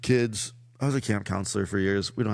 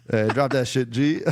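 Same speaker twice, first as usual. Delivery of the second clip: hammed-up street talk; 3 semitones higher, 4 dB louder than usual. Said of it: "bro, come on now".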